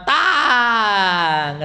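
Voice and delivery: a loud, laughing clang mimicking a punch; mock clang of a blow. Preacher mimicking a punch landing, loud and laughing. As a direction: bang it out like being struck. Kata dia.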